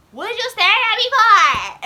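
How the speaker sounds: high, slow, loud sneering jab; mocking mean girl. Mean classmate snapping at the daydreaming girl, mock sneer. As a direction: throw the accusation at her like a mean girl. Girls confronting her in class.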